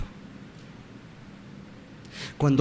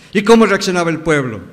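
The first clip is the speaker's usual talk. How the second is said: ordinary delivery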